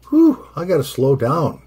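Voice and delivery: puffing out "Whew" before the words; winded exhale. Real, not acted. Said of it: "feel the nicotine pumping".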